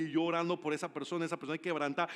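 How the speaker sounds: ordinary talk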